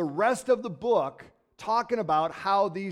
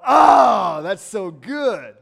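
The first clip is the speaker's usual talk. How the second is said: excited exclamation; 3 semitones higher, 14 dB louder, much slower than usual. Pretend. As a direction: burst out like a fired-up worshipper. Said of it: "you just wanna go".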